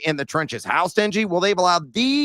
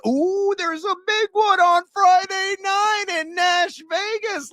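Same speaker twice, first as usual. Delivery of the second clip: hyped announcer shout; 14 semitones higher, slower than usual. Acted. Hyping big game.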